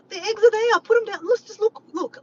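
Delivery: high, loud acted-out yelling; yelling back. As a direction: shout back, reenacting the exasperated exchange. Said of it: "I'm yelling back and forth".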